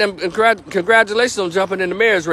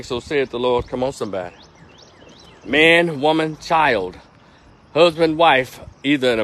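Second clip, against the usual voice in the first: mock preacher; 5 semitones lower than usual. Preacher parody.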